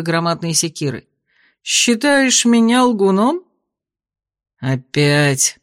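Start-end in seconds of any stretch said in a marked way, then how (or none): 1.6-3.4 gently menacing giant; friendly monster. A narrator's reading. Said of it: "Почти ласково прорычал". 4.6-5.6 exasperated wince. A narrator's reading. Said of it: "Поморщился яжик".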